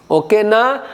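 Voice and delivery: ordinary delivery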